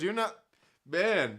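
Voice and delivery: ordinary talk, nothing marked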